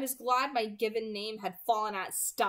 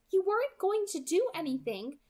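put-on higher girlish voice, gentle reassurance; Alice soothing; 9 semitones higher, 3 dB louder than usual. Alice reassuring ashamed Jasper, higher soothing voice. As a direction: comfort him, lightly. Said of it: "Alice murmured to him".

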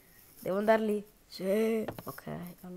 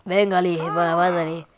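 bossy staff voice; 3 semitones lower, 9 dB louder than usual. Pretend. Staff orders patient.

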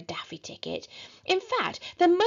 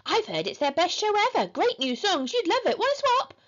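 high, eager false cheer; perky, pitched-up persuasion; bright sales pitch; 10 semitones higher than usual. A narrator's reading. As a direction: talk it up chirpily to win a swap. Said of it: "said horrid Henry brightly".

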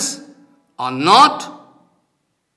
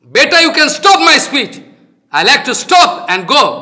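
raised, heated protest; real indignant outburst; 7 semitones higher, 6 dB louder than usual. Real, not acted. Teacher tells listeners he would rather stop his speech, indignant. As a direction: threaten to walk out, voice raised.

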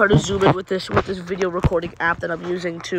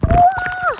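mock scream; 6 dB louder than usual. Pretend drowning.

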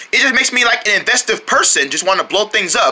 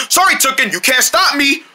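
ordinary talk, nothing marked

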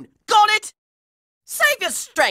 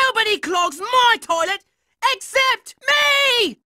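defiant shout; 5 semitones higher than usual. Acted. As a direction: stretch out both words triumphantly. Defiant claim to the toilet.

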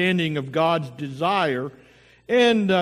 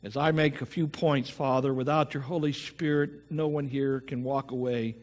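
opening prayer; 4 semitones lower than usual. Acted. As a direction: pray gently over the congregation. Pastor leads the opening prayer.

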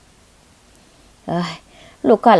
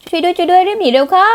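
panicked cry for help; 11 semitones higher, 3 dB louder than usual. Acted. Gunshots, crying for help.

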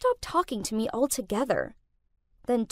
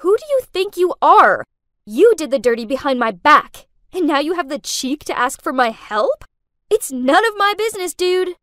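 loud, furious yelling, raised higher; 4 semitones higher, 7 dB louder than usual. Acted. Girlfriend yelling at her boyfriend for asking money, furious. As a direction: yell it at him in a fury. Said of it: "Furious, I yelled".

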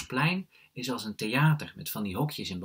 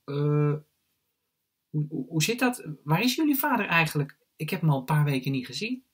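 put-on higher-pitched hesitant child voice; cautious, hesitant question; 7 semitones higher, 6 dB louder than usual. A narrator's reading. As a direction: stumble into an awkward question. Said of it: "vraagt Vaas voorzichtig".

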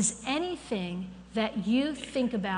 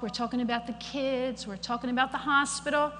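ordinary delivery

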